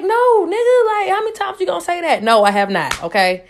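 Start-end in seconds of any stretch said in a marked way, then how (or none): 0.0-2.2 high, exasperated snap; pitched way up in annoyance; exasperated retort. Retold or imitated. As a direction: fire back, fed up with the question. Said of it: "I'm like".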